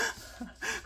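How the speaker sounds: giggling fit, high and breathy; high burst of laughter. Real, not acted. Laughing at herself while crafting.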